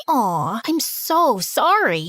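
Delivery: plain reading voice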